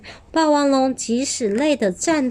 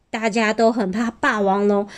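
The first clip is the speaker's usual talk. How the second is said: ordinary delivery, nothing marked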